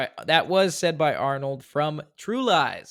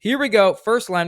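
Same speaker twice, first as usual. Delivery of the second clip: excited; 8 semitones higher, 8 dB louder than usual. Real, not acted. Host's excited game kickoff.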